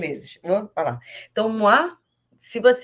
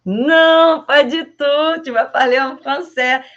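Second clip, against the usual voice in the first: louder, higher Non, pas du tout; emphatic no; 6 semitones higher, 8 dB louder, slower than usual. Pretend. Teacher playfully refusing Portuguese, loud. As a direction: insist firmly. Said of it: "Aí eu ia falar em português?".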